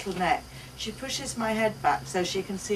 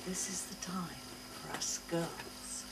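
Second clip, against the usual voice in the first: hushed whisper, much softer; 12 dB quieter than usual. A narrator's reading. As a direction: whisper it like a shared secret. Secret confiding aside.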